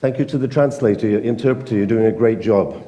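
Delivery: ordinary talk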